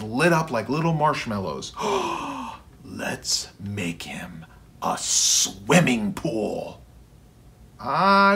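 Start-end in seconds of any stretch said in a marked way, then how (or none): 1.8-2.5 gasp. Acted. Ted hears swimming idea. 2.8-6.8 slow conspiratorial whisper. Acted. Ted shares secret plan.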